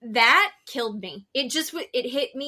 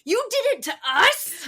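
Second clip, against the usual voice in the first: high-pitched imitation of a shocked kid; shocked disbelief; 7 semitones higher, 4 dB louder than usual. Mother retelling her other son's sudden realization, shocked. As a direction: voice jumping up in mock betrayal.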